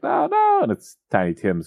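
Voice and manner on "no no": mock ghost. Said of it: "And he's like".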